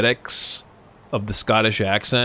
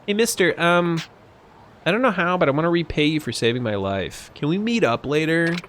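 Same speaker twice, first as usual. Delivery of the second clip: put-on higher, lightly girlish voice; grateful young woman; 5 semitones higher than usual. Young woman asking her rescuer to meet, lightly girlish and shy. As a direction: shyly thank your rescuer.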